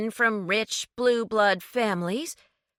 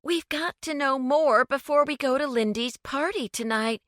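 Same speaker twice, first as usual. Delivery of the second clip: higher, fast and urgent; urgent higher pleading; alarmed urgency; 9 semitones higher than usual. A narrator's reading. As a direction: rush him, she's in a panic. Lucy alarmed, urging James.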